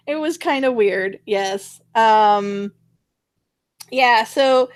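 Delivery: usual speaking voice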